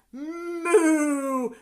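long, loud, high moo. A narrator's reading. Cow joining in as the thieves are caught, loud moo. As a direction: let out a big cow moo. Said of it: "the cow said".

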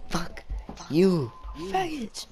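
high, drawn-out yell that cracks; slow, squeaky voice-crack outburst. Shown as a demonstration. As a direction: stretch the words out high until the voice breaks. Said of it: "Still got voice cracks".

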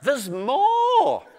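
high, urgent little creature; muskrat voice. Acted. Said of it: "shouted the muskrat".

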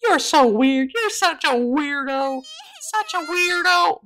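grossed-out. Acted. Grossed-out friend.

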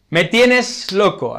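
lovestruck declaration. Shown as a demonstration. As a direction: say it like you're madly in love. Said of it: "Aquí utilizamos el verbo loco". (declaración apasionada y lenta)

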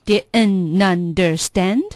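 very slow "didn't understand" as di'n-nunderSTAND; linked drill echo. Shown as a demonstration. Learner echoing the teacher, slow linked "didn't understand". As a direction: repeat "didn't understand" slowly without t. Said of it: "我们来分段练一下".

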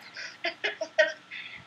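airy unvoiced giggle; breathy silent laugh. Real, not acted. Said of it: "I like how you looked up".

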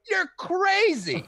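very high, slow, loud, laughing; drawn-out amazed put-on voice; mock stoner disbelief. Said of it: "he's like".

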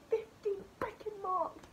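a high, slow, breaking cry of frustration; tearful outburst. Real, not acted. Upset over fifteen lost marks, crying out in frustration. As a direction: let the exasperation break into tears.

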